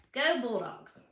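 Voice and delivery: usual speaking voice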